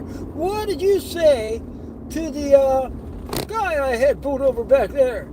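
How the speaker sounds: high, drawn-out angry demand; imitated screaming cop. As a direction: stretch out the cop's furious question. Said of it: "he's screaming at the clients".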